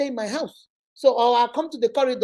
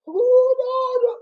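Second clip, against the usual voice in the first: sudden high cry of no, much higher and louder; alarmed protest; 12 semitones higher, 4 dB louder, much slower than usual. Retold or imitated. Schoolgirl told to sing by principal, panicked high no.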